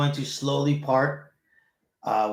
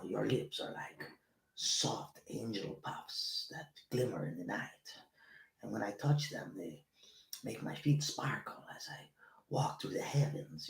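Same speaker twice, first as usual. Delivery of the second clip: seductive lover; 9 dB quieter than usual. Acted. Host voicing his sultry inner guy, softer mock-romantic whisper. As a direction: breathe out mock-romantic lines like a crooner. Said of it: "my guy talks like this".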